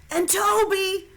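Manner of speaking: slow, high loud call. A narrator's reading. Little brother calls after his sister, high and loud. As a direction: call out from behind. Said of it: "Toby called".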